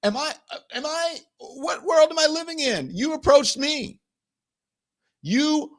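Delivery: high incredulous comeback, settling into firm accusation; indignant disbelief. Retold or imitated. Answering the girlfriend's attack, indignant disbelief.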